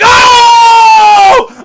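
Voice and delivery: very high loud shout of awe; overwhelmed hype cry; hyped yelp. Retold or imitated. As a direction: shriek at the screen. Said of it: "I'd be like".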